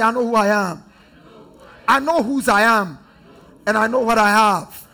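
loud, rising chorus of affirmation; loud congregation declaration. Real, not acted. Preacher leading the congregation in a loud declaration. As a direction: shout the confession back. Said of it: "Somebody say".

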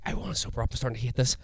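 breathy near-whisper; half-whispered panicky plea. Retold or imitated. Panicky high, quoting himself.

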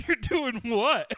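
high, loud, drawn-out mock disbelief; slow incredulous yelp through laughter; acting out alarmed incredulity; freaking out. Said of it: "I'm freaking out".

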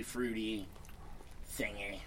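usual speaking voice

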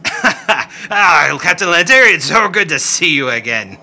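high, loud, laughing welcome; overjoyed, fawning host; gushing Rickety. Acted. Rickety greets the captain, loud and laughing. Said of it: "And he jumps up and says".

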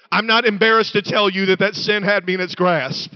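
loud, driving preacher cadence; full-force preaching. Real, not acted. Preacher declaring his freedom to the congregation, loud and driving. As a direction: preach it out to the back row.

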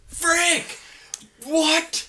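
very high, comic yelps of dismay; high-pitched, exasperated disbelief; shocked outrage. Real, not acted. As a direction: cry out as if the ending is ruined. Mock shock at obvious spoiler.

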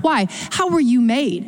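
ordinary talk

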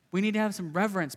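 normal talking voice

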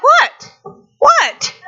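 high squeaky startled what; confused surprise. Retold or imitated. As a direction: blurt it out, baffled and high. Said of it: "You're like".

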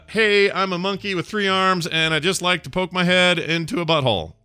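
high, chirpy caller voice reading nonsense; mock caller. Reading a garbled voicemail transcript. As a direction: read the garbled message in a bright caller voice. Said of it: "I'll read the translation".

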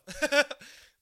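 loud high burst of laughter; big burst of laughter. Real, not acted. Host bursts out laughing at his own joke. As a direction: cracks up hard at his own dark joke.

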